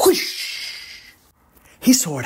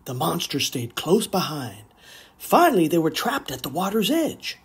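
ordinary delivery, nothing marked

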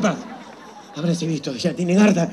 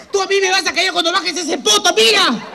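laughing taunt; 7 semitones higher than usual. Acted.

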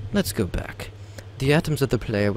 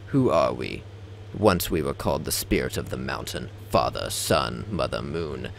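lower, measured voice of an ancient being; low entity voice; 6 semitones lower, 4 dB quieter than usual. Acted. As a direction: list the old names in a grave voice.

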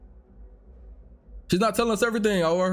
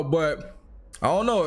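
ordinary delivery, nothing marked

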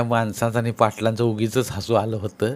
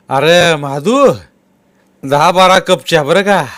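loud, higher call with a stretched name; 6 semitones higher, 10 dB louder, slower than usual. Acted. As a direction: shout the order across to the servant. Patil calls out a tea order. (मोठ्याने हाक मारत फर्मान)

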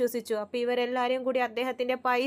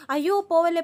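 alarmed plea; 5 semitones higher, 6 dB louder than usual. Retold or imitated. Uncle panics to stop her.

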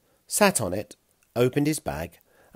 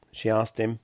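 ordinary delivery

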